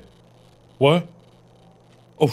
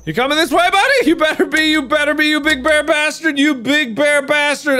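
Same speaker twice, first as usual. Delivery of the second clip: mock taunting; 8 semitones higher, much faster than usual. Real, not acted. Taunting the bear to come.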